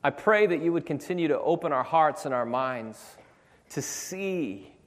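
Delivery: rising, fervent prayer over music. Acted. Pastor praying over the congregation, building to fervent. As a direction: pray with growing fervour.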